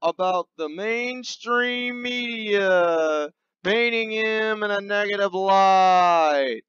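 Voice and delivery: ordinary delivery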